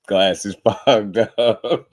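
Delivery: slowed right down, laughing through the words; giggling between the words. Real, not acted. Laughing to viewers about his fogged-up glasses. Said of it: "Y'all know I like to come on here laughing".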